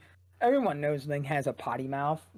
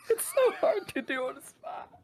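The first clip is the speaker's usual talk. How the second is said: high squeal through laughter; embarrassed, laughing, voice shooting way up; flustered laughter; 14 semitones higher than usual. Real, not acted. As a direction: crack up helplessly while complaining. Cracks up failing the requested voice.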